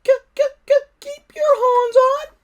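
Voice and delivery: very high, loud squeaky stutter, slowing on the end; stammering little dragon. Acted. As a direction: stutter it out like a cheeky tiny dragon. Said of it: "grumbled Toothless".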